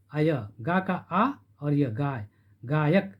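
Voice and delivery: गायक split slowly as गा, आ, य; slow syllable demo. Shown as a demonstration. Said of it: "यहाँ पर आय हो गया". (गायक को धीरे-धीरे अक्षरों में तोड़ना)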